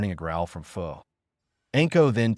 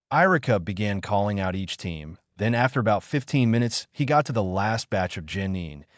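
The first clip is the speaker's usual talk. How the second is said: usual speaking voice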